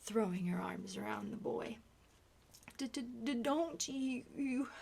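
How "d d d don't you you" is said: put-on higher child's voice stuck on d-; boy stuttering at his father. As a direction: push through the stutter.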